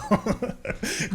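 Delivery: real unvoiced chuckle; breathy laugh. Real, not acted. Teasing the friend who hasn't seen it, airy laugh.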